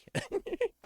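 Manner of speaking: a high, bursting laugh; high squeal of laughter. Real, not acted. Streamer laughs at own crude joke, squealing high.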